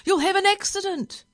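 high, loud warning cry; alarmed yell. A narrator's reading. Passengers warning the speeding driver, yelling. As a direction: shout a panicky warning. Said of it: "yelled the passengers".

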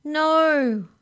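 very loud, high wail of protest; crying out. A narrator's reading. Molly protesting the penitentiary, crying out. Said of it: "cried Molly".

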